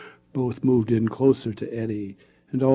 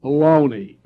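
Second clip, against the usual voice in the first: loud scoffing baloney; snorting; 4 semitones higher, 10 dB louder than usual. Acted. Ted scoffing at the story's danger, loud and snorting. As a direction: snort it off in disbelief. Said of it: "Ted snorted".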